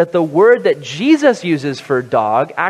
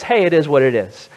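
usual speaking voice

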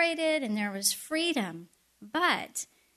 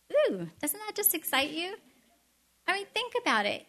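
higher, quick bubbling enthusiasm; delighted excitement; 6 semitones higher, faster than usual. Real, not acted. Preacher thrilled telling sweet-tooth listeners about manna, excited. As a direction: gush about it like a treat.

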